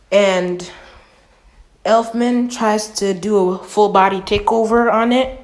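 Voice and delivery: usual speaking voice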